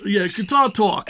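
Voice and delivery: slow, broad Long Island accent; drawn-out Long Island accent. Real, not acted. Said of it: "That's my Long Island accent coming out there".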